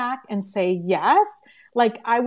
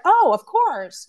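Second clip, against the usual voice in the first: high, louder, slow delighted oh; sudden bright yes, drawn out; glad, slow high "Oh, of course"; excited agreement; 8 semitones higher, 4 dB louder, slower than usual. Retold or imitated. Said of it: "And she's like".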